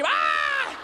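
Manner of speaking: un « Ah! » aigu de surprise; cri de surprise. Retold or imitated. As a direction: criez le choc en le voyant. Cri de l'albinos en le voyant. (l'albinos voit le brûlé et crie « Ah! » de surprise)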